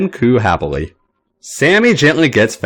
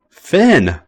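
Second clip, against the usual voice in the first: higher singsong warning of his name; 8 semitones higher than usual. A narrator's reading. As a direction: sing his name as a playful threat. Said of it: "Sammy sings songs warningly".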